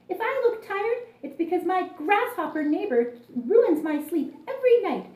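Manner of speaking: a tired ant grumbling at her noisy neighbor; huffy. A narrator's reading. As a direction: huff it out, fed up and put upon. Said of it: "huffed Mimi".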